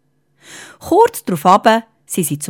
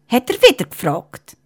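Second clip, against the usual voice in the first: ordinary delivery, nothing marked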